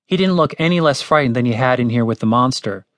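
ordinary delivery, nothing marked

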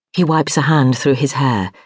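ordinary delivery, nothing marked

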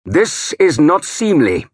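a king's stern angry reproach. A narrator's reading. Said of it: "Alfred said angrily".

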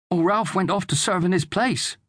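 high-pitched lad's voice; protesting boy. A narrator's reading. Son wanting to join the fight, protesting. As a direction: argue as an eager youngster. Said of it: "said Daniel".

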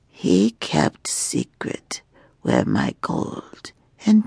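slow, hushed, menacing whisper of a dragon queen; taunting dragon woman. Acted. Dragon woman taunting her chained prisoner, slow hushed menace. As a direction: let the threat creep out in a breathy hush.

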